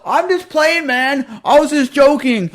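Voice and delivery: high, whining "just playing" in a mocking voice; mock the bully backing down; whiny bully impression. Mocking the bully who backs down, whining. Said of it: "and then it's all".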